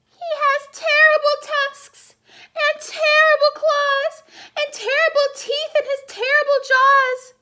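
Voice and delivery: tiny mouse turning down the fox; little mouse. Acted. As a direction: play the mouse, bright and plucky. Mouse describes the Gruffalo.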